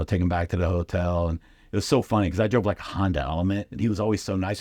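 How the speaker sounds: ordinary talk, nothing marked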